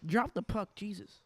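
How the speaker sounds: live hockey play-by-play; play-by-play commentary. Acted. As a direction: call the game as it happens.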